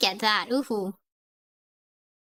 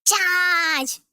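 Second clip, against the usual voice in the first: higher mock battle cry; 4 semitones higher, 3 dB louder than usual. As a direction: call the troops to charge, drawn out. Acts out the Southerner's battle cry.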